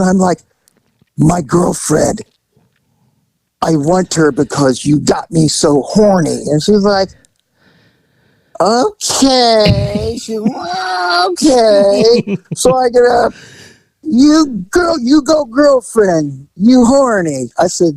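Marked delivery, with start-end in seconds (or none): 1.2-6.4 slow, deliberate over-explaining. Retold or imitated. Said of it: "talk real slow and louder". 8.7-12.4 high, drawn-out doubtful okay; doubtful masseuse. Retold or imitated. Masseuse to client who makes excuses, unsure and high. 14.0-17.5 high, slow broken-English voice; mock masseuse in broken English. Masseuse cheering her client, high and halting.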